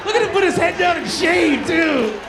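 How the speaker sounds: high, loud mock scolding; shouting tease; loud teasing. Real, not acted. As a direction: call him out loudly. Teasing audience member.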